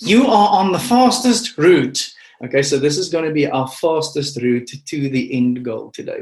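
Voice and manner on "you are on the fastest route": high, loud, urgent sat-nav voice; urgent GPS lady. Retold or imitated. Preacher imitating the Google Maps lady, high and urgent. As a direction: sound like a sat-nav announcing a route. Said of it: "the urgency with which the lady on Google Maps tells me".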